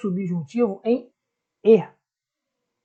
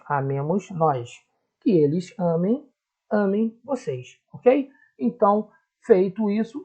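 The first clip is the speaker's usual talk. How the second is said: ordinary talk, nothing marked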